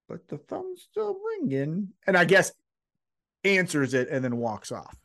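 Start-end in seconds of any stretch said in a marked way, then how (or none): 0.1-1.9 mock high voice. Retold quote, high voice.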